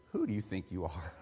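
a quiet inner voice of God rebuking him. Retold or imitated. God rebuking the young pastor in his head, low and soft. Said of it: "God started saying".

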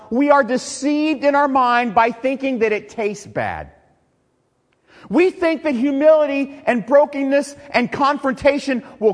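loud, high preaching at full force. Acted. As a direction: drive the point home to the congregation. Preaching to congregation.

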